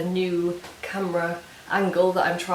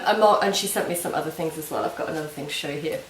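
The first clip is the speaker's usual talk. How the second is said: usual speaking voice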